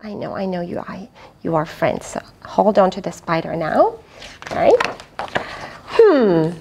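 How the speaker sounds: usual speaking voice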